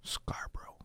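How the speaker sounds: hushed whispered reply; barely voiced, very soft whisper. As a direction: whisper it under your breath. Mock-secretive answer about hometown.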